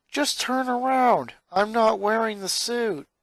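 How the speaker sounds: put-on a boy insisting gently; boy's voice. A narrator's reading. Lincoln voiced by narrator.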